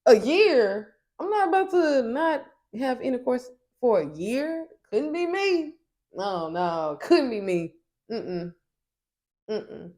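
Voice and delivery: aghast, loud disbelief; incredulous outburst. Retold or imitated. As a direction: Amari in confessional, loud and incredulous. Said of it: "in confessionals, he was like".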